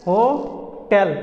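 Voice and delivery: "होटल" slowly as "ho... tel"; syllable-by-syllable correction. Shown as a demonstration. Teacher drilling the new form, slow corrected "ho-tel". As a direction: pause inside "होटल", clear "tel" ending. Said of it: "अब बोलेंगे हम लोग क्या".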